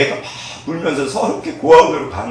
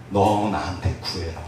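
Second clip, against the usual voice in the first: voice of God; 9 semitones lower, 8 dB quieter, slower than usual. Acted. God speaks to believer.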